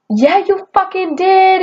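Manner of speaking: high, slow mock yell of an angry customer; hammed-up angry lady, slow and high; retold screaming customer. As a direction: imitate her shrieking at you, half-hearted. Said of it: "screaming at me".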